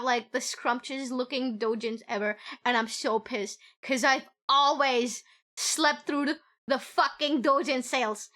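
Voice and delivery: normal talking voice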